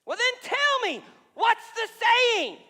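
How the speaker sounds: a frantic king shouting for the answer; desperate shout. Acted. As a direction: yell at Daniel to spit it out. King yells at Daniel.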